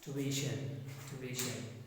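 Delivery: "ट्यूशन" with a half t, a short pause, then "यूशन"; gapped "ट्यूशन" drill; tuition with gap. Shown as a demonstration. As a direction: leave a tiny gap after the t. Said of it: "थोड़ा सा gap देके".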